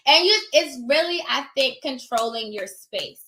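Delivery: ordinary talk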